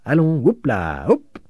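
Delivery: high, drawn-out urging cry; playful stretched hup; calling out. A narrator's reading. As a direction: egg him on like an animal trainer. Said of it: "lui cria le peintre".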